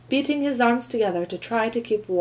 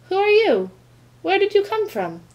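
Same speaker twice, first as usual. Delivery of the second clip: surprised; 11 semitones higher, 4 dB louder than usual. A narrator's reading. Surprised by a bird.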